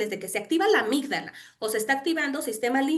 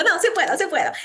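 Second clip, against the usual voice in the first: higher, louder "sí puedo" pep talk; determined self talk; 7 semitones higher, 5 dB louder, faster than usual. Retold or imitated. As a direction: psych yourself up, a little louder. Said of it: "Y entonces digo". (se anima a sí misma, más alto y decidido)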